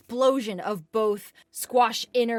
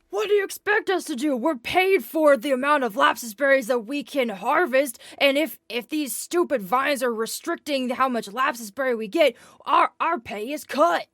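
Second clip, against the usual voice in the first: higher and louder, pushing back hard; defensive shout; 5 semitones higher, 4 dB louder than usual. Acted. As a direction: protest heatedly, talking fast. Farmer argues back at Aelin.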